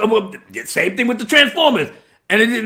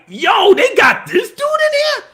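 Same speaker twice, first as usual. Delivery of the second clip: high-pitched disbelief; retold excited double take; amazed reaction; 12 semitones higher than usual. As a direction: exclaim it high, astonished at the cameo. Retelling his amazed reaction.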